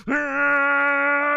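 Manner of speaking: high, loud, sustained anime scream; power-up scream. Retold or imitated. Streamer imitates Goku powering up, long high scream. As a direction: hold a full-force charging-up yell. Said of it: "And Goku's powering up".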